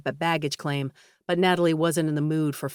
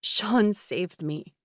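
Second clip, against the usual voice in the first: tearful; 2 semitones higher, much slower than usual. A narrator's reading. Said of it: "tears clouded her vision".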